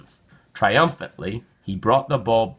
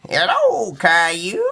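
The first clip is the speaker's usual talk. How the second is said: lightly voiced kind grandmother; a hint of a sweet granny; grandma voice; 10 semitones higher, 4 dB louder, slower than usual. A narrator's reading. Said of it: "Grandma said".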